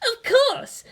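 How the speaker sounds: put-on high, bossy girl; bratty teen girl voice. A narrator's reading. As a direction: voice the proud girl. Asuka's voice, high.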